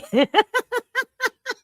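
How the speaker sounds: squealing high laugh; real helpless laughter. Real, not acted. Reacting to the comment.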